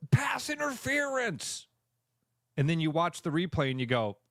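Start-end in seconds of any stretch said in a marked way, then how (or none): 0.1-1.7 high, drawn-out mock holler; mock fan yell. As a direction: imitate a fan yelling at the game. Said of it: "you hear somebody yell".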